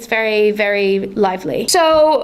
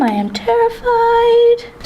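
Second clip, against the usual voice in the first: mock terrified; 11 semitones higher, slower than usual. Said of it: "Plans for your gnome".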